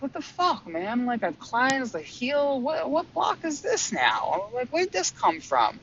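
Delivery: high, incredulous whine about the block; annoyed disbelief, pitched far up; exasperated complaint. Retold or imitated. Complaining to her guides about a new block, high and exasperated. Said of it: "at first I was like".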